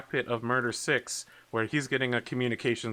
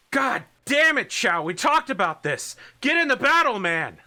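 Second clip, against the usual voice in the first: loud, high scolding yell; exasperated shouting; 11 semitones higher, 6 dB louder than usual. Acted. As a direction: chew out a soldier over the radio. Haller scolding a pilot in battle.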